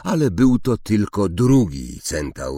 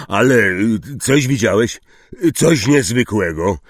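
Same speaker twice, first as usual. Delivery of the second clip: loud, hesitant questioning; forceful, impatient query; pressing Hagrid; 4 semitones higher, 6 dB louder than usual. Acted. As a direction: nudge him for a real answer. Hagrid presses the vague centaur.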